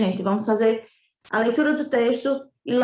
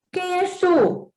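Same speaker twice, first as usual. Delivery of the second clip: higher, slow little prince voice; 7 semitones higher, much slower than usual. Acted. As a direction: ask slowly as a small boy. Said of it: "perguntou o príncipezinho". (voz de menino, mais aguda e lenta)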